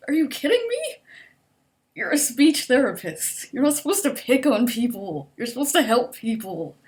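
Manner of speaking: loud, high outrage; loud indignant rant, pitch up. Real, not acted. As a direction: scold her in disbelief. Outraged at speech therapist.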